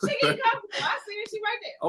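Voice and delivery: laugh-crying. Real, not acted. Laughing-crying over friend.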